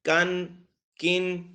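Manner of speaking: slow teaching recitation; recited kan. Shown as a demonstration. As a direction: chant کن clearly for the student. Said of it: "دونوں کاف تھے".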